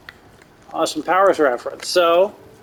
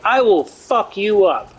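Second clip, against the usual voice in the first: drawn-out, higher mock menace; imitating a menacing guard, slowly; slow threat; 4 semitones higher than usual. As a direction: lean in and draw the threat out slowly.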